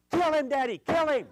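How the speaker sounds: high, loud mock wail of a child; child's scream. The boy yelling at his daddy to kill the turtle, loud high screaming. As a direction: shriek it like a furious five-year-old. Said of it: "he begins to scream and cry".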